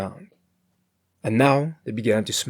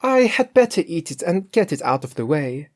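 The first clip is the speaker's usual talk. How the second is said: Grethel's voice; 6 semitones higher, 5 dB louder than usual. A narrator's reading.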